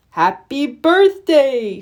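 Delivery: slow, put-on snowman voice, dropping at the end; a newly woken snowman greeting the children, slow. Snowman comes to life and greets the children, slow put-on voice. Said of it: "Frosty said".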